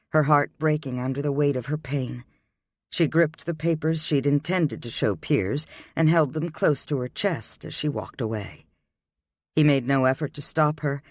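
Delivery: ordinary delivery, nothing marked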